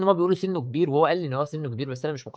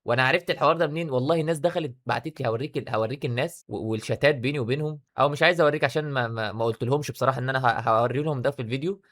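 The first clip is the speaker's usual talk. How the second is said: ordinary talk, nothing marked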